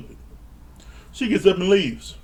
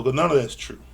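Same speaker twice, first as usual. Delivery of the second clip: ordinary talk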